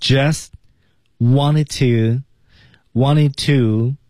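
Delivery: "Just" with the final t held back, then slow "wanted to"; unreleased T. Shown as a demonstration. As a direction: sound out "wanted to" very slowly. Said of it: "just，T 失去爆破".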